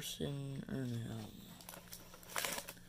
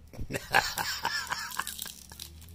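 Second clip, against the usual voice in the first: breathy laugh. Real, not acted. Laughing at the print odds.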